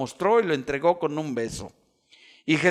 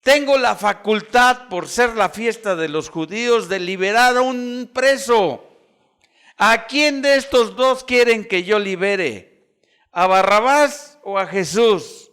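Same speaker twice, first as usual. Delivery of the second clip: loud, raised voice of a governor addressing a crowd; Pilato ante el pueblo; 6 semitones higher, 7 dB louder than usual. Retold or imitated. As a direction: proclaim it high and loud to the assembled people. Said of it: "Pilato les hace una pregunta a todo el pueblo". (Pilato proclamando en voz alta ante la multitud)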